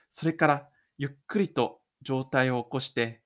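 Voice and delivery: ordinary delivery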